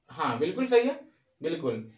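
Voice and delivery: ordinary talk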